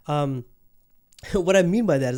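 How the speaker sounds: ordinary talk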